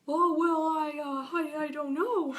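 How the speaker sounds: high, loud, halting little character voice; high-pitched cartoon dither; hesitant little doll. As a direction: stammer it out as an unsure cartoon doll. Voicing timid doll Little Jeremy.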